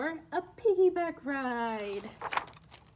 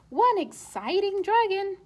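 excited; 5 semitones higher, 5 dB louder than usual. A narrator's reading. Narrator hypes the dragon.

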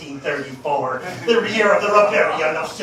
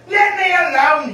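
angry outburst; 8 semitones higher, 6 dB louder than usual. Acted. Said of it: "he says".

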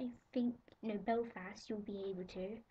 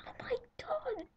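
much higher squealed oh my god; pretend fangirl squeal; slower than usual. Girl acting out getting the celebrity call, high squeal. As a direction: squeal like the call just came. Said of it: "I just did that on camera".

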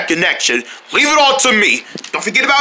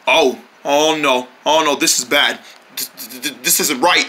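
ordinary talk

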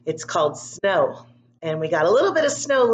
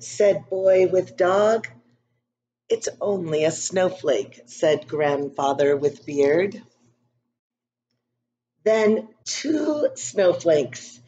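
put-on calm storybook narration for young kids; story reading; slower than usual. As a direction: read it gently to a class of children. Reads picture book to class.

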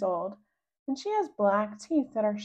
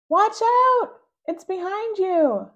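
urgent warning shout; 11 semitones higher, 9 dB louder, slower than usual. Acted. Rascal warning siblings of monsters.

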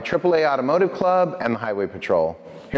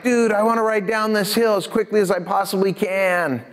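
excited; 7 semitones higher than usual. Retold or imitated. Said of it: "I look at my best friend all excited".

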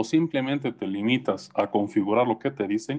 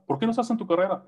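mock incredulous relative; 8 semitones higher, much faster than usual. Relative questioning.

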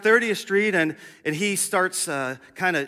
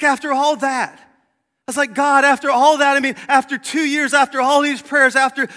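exasperated cry; 8 semitones higher, 6 dB louder than usual. Retold or imitated.

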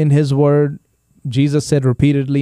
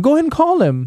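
a high, lightly voiced mom urging; mom's voice; 13 semitones higher, faster than usual. Mom telling dad to call her son, in a high voice. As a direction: imitate mom nudging dad to phone. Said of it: "That was your mom talking to your dad".

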